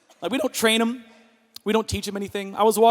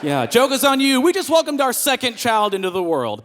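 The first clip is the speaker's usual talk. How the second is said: ordinary talk, nothing marked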